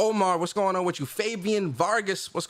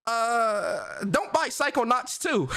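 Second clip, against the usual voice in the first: higher, hammed-up jokey voice; a soundbite line, put on and higher; mock soundbite voice; 5 semitones higher, slower than usual. Shown as a demonstration. Streamer recording a soundbite for chat, hammed-up higher voice. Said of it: "I'm about to create a new sound bite right now".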